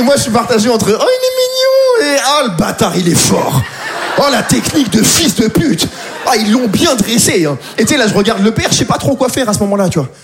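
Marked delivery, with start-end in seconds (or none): 1.0-2.1 very high, slow, squealed awe; gushing. Retold or imitated. Comedian melting as the baby reaches for him, very high and slow. 2.3-7.6 grudging admiration. Retold or imitated. Grudging admiration of baby's trick.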